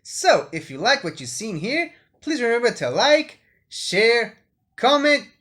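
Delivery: loud, bright sign-off to viewers; YouTube outro voice. Acted. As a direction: hype up the like and subscribe. Channel outro.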